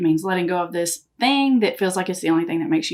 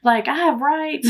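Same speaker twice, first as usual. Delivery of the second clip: put-on higher, indignant kid voice; imitated protesting child; 7 semitones higher than usual. Son argues with mom over his rights, mock indignant. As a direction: push back like a kid defending himself.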